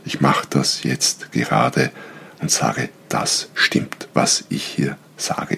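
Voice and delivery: low, drawn-out insistence; slightly exaggerated assertion. Shown as a demonstration. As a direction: press each word down slowly and firmly. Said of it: "Ich übertreibe es ein bisschen".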